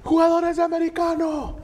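high, sustained kitchen holler; calling out an order. Retold or imitated. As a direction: bellow it across a busy kitchen. (pregón a gritos)

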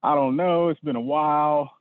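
reluctant. Retold or imitated. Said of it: "at first I kinda was like".

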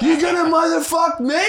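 high, loud laughing coach shout; yelling coach. Retold or imitated. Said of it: "yelled at us".

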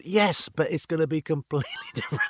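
insist, then break into a laugh; exasperated laugh on different; laughing insistence. Retold or imitated. Laughing at his own retold reply.

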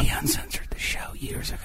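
whispering. Real, not acted. Whispered aside.

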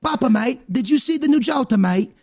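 high-pitched child with mock English accent; kid's bad English accent. Kid tells papa about new card in bad English accent. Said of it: "and then the kid's like".

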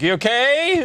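worried mom. Retold or imitated. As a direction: lightly mimic a mother checking on him. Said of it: "My, my mom was like".

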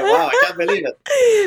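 high, laughing disbelief; thrilled and amazed; surprised laughing. Real, not acted. Surprised she speaks French.

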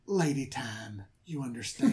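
confidential whisper. Retold or imitated. Daughter whispering her excuse to her father, hushed. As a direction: whisper it like a secret. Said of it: "And she goes".

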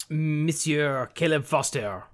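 slow, drawn-out mock French accent. Host calls up the next questioner in a French accent. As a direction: put on a theatrical French accent, stretching each name. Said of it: "Do we do this in a French accent".

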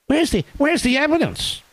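high whiny mock questioning. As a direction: whine it like a skeptic. Mocking media critics.

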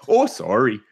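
put-on Canadian "oh, sorry"; mock-polite Canadian apology; Canadian accent. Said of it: "there's my amazing Canadian, uh, accent".

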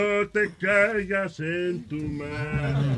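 very slow, each word drawn out; slow, deliberate repeat on request; said slowly. Shown as a demonstration. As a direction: spell it out slowly so every word lands. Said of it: "Pero dilo más lento".

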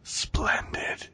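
breathy mock-sinister whisper; mock villain whisper; creepy whisper. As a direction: whisper it like a creep savoring the word. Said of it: "your whispering in my butthole".

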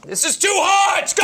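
loud, high pained shout; hot tea yelp. Real, not acted. Burnt by tea, shouting out loud. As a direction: yell as the hot tea burns.

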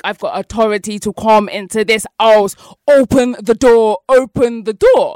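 slow, loud open the door; pounding out the order; loud demand. Retold or imitated. Intruder to her at the door, slow loud demand.

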